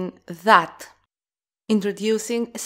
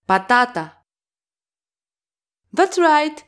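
usual speaking voice

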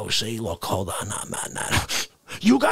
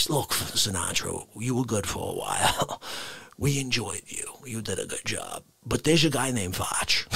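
much lower, soft, confiding; low hushed note; 11 semitones lower, 7 dB quieter than usual. Acted. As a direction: read heaven's note in a hush. Note from heaven voiced low.

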